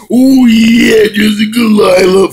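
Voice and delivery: loud, high wrestler impression; Bonesaw McGraw. Quoting a wrestler about Jessica, hyped-up holler. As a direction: belt it out with stretched vowels. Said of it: "from Bonesaw McGraw".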